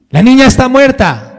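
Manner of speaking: high, loud mock lament; wailing crowd. Preacher voicing mourners announcing the girl's death, high and loud. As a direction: cry it out like a grieving mourner.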